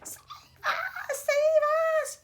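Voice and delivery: very high screaming plea; panicked yell. A narrator's reading. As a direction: scream for rescue. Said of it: "yelled Grandma Georgina".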